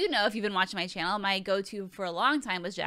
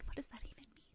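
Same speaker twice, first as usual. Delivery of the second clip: whisper; 5 semitones higher, 17 dB quieter, much faster than usual. Real, not acted. Mock aside to herself.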